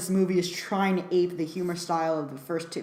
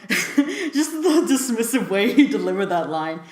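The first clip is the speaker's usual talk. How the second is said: laughing; 3 semitones higher, 4 dB louder than usual. Real, not acted.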